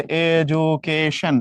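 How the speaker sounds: এডুকেশন stretched slowly into its syllables. Shown as a demonstration. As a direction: break up এডুকেশন slowly for counting. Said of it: "এখানে কয়টা syllable".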